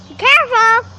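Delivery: loud warning shout; calling out. Real, not acted. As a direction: call out to warn someone. Child calls warning to mom.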